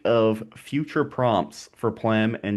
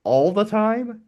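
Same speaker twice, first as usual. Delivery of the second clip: incredulous; 9 semitones higher, slower than usual. Real, not acted. Incredulous at friend's sushi claim.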